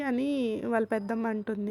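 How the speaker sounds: ordinary delivery, nothing marked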